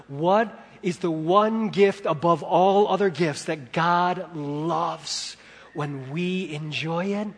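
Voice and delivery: normal talking voice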